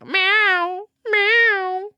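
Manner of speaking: loud high cat meows; loud meowing. Pretend. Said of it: "Mushroom did not like that I did that".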